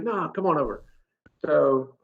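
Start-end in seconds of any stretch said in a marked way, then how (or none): 0.0-0.8 insistent salesman. Retold or imitated. Salesman insists he come over.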